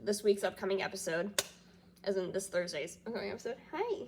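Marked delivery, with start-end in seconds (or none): 3.7-4.0 talking to dog. Real, not acted. Vlogger greeting her grandparents' dog in baby talk. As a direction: coo hi to a pet.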